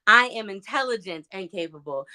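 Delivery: "intelligent" punched out above the rest; stress on INTELLIGENT. Shown as a demonstration. Demonstrating the affirmation word by word, stress on "intelligent". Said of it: "I would put emphasis on every single one of those words".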